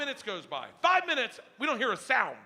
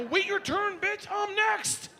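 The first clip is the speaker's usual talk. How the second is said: eager mock snap; 13 semitones higher, slower than usual. Brushing off daughter, eager to hoot.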